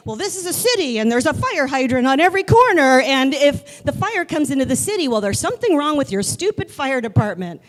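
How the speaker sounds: put-on loud, high stubborn old man; stubborn grandpa. Grandpa refusing to leave, voiced.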